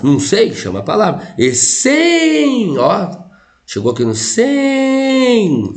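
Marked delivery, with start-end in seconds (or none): 1.4-2.8 Essen called out high and drawn out, like shouting to someone far off; calling out the word. Shown as a demonstration. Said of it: "Chama palavras". 3.6-5.7 slow, high calling voice lingering on sem; stretched high calling. Shown as a demonstration. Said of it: "Ficou forte".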